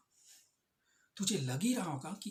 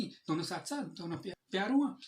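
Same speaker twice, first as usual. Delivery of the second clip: usual speaking voice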